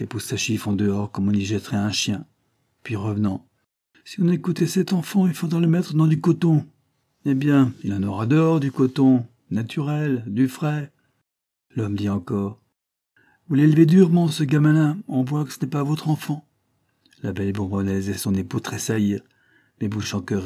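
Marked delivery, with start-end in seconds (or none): 4.0-10.9 higher-pitched, exasperated scoffing; mock the coddling of a child; sarcastic, dismissive grumble; scornful man. 13.5-16.5 loud, higher-pitched man's remark; raised voice, needling the parents; reproachful visitor. Acted. Man remarks on child's harsh treatment.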